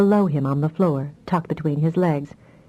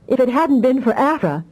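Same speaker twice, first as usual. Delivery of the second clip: higher, excited, stress on "hadn't"; "hadn't" lifted and leaned on in an eager exclamation; exclaiming; 7 semitones higher than usual. A narrator's reading. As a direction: blurt it out, "hadn't" carried highest. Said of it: "she exclaimed".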